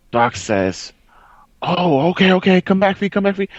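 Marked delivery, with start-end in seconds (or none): 1.6-3.5 high, urgent teammate callout. Retold or imitated. Teammate tells Fee to come back, urgent callout. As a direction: call a teammate back in a higher, pressing voice.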